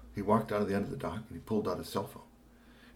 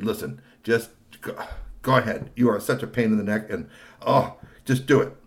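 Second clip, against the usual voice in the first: mild exasperation; 2 semitones higher, 7 dB louder than usual. Acted. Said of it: "got exasperated and said".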